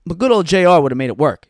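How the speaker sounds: normal talking voice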